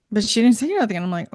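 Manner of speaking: ordinary talk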